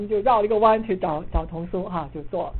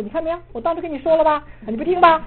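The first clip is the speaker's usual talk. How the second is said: fast, much higher and louder mock scolding; mock told-you-so; 10 semitones higher, 8 dB louder, faster than usual. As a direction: rattle off the smug blame. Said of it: "你不会说". (学人说风凉话，又快又高又响)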